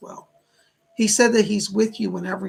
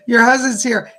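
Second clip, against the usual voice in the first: excited; 3 semitones higher, 11 dB louder than usual. Real, not acted. Spirit arrives after a struggle.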